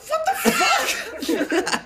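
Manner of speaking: very high, slow, laughing disbelief; baffled by a random remark; stunned disbelief. Retold or imitated. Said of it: "I'm like".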